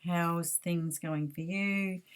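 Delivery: ordinary delivery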